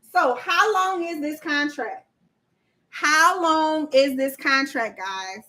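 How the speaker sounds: drawn-out quiz question to the viewers; slow the question down for the class; quizzing slowly. Shown as a demonstration. Host quizzing her live chat, slow and deliberate. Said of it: "pop quiz".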